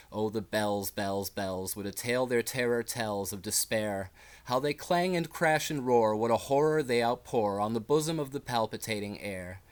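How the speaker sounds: dramatic reading of a poem with rising and falling force; poem recitation. Acted. Reading Poe's The Bells to channel viewers, dramatic recitation. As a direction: recite it like a performer, letting the bells swell and fade.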